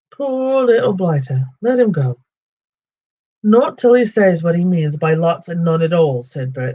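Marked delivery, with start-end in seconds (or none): 0.1-2.2 pitying troll. Acted. Troll William pities Bilbo. 3.4-6.2 arguing troll. Acted. Troll Bert argues.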